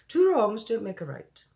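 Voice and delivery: a lightly voiced giant chimes in; BFG crying out. The giant joins the argument, a lightly voiced high cry. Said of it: "cried the B F G".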